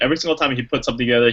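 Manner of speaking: ordinary talk, nothing marked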